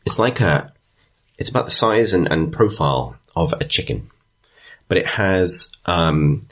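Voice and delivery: ordinary talk, nothing marked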